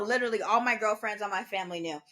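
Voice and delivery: normal talking voice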